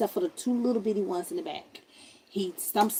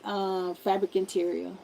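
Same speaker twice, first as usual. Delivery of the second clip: ordinary talk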